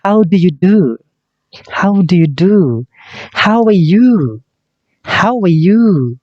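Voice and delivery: slow, clear drill phrases for the class; repeat-after-me drill. Shown as a demonstration. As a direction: model each phrase for students to echo. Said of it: "ikuti [name]".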